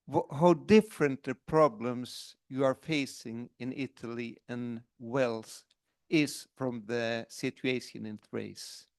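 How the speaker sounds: usual speaking voice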